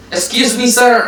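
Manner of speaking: high-pitched eager call to a foreigner; put-on high tourist-greeting voice; kids accosting a tourist. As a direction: imitate a child calling out to a stranger, high and loud. Children accost him as a tourist.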